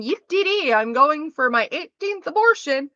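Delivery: sing-song fake glee to mock the idea; mock cheerful. Mocking anyone who'd cheer an abortion, high sarcastic glee.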